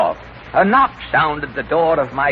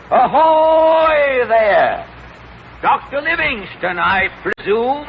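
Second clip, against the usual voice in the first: calling out; 10 semitones higher, slower than usual. Acted. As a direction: hail the stranger across the clearing. Calling out to approaching figure.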